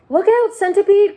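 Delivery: a high, loud cry of warning; frantic shout. Acted. James warning the dancing centipede at the edge, shouting. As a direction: yell to stop him falling. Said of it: "cried James".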